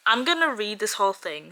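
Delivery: ordinary talk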